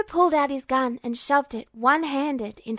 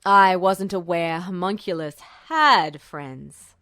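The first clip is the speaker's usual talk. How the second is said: low, slow musing under the breath; a skeptical officer thinking aloud; general, half to himself; 6 semitones lower, 4 dB louder than usual. Acted. General musing to himself about Duo, low and slow. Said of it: "almost to himself".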